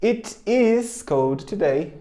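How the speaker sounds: It is cold today, slow with it and is apart; teacher modelling the long form It is; full form, slow. Shown as a demonstration. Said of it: "pode contrair".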